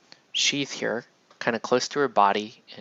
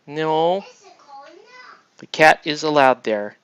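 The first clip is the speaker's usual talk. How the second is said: louder, higher, scolding the cat; raised voice; 4 semitones higher, 8 dB louder than usual. Real, not acted. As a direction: call out sharply to the pet.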